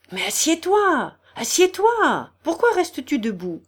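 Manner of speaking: high, insistent cries; alarmed urging. A narrator's reading. General's wife making the sick man sit, alarmed. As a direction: press him anxiously.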